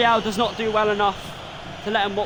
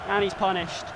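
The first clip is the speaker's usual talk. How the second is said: normal talking voice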